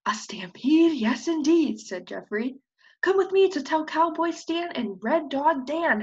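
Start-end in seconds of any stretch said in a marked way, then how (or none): 0.0-1.8 whispered, hushed awe; awed whisper. Acted. Prairie dog whispers back the frightening news. 3.0-6.0 high, loud hollering; hollered call. Acted. Hen hollers to friends to run to the ranch. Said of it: "hollered Mary".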